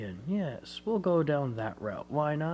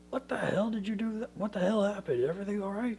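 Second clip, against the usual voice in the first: much higher, quick and alarmed; alarmed business partner; 9 semitones higher, faster than usual. Retold or imitated. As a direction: rattle it off like a startled colleague on the phone. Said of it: "his business partner's going".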